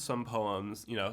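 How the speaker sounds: usual speaking voice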